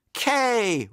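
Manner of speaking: high, drawn-out chant response; high cheering echo; crowd repeating the cheer. A narrator's reading. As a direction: call it back like cheering fans. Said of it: "repeated the fans".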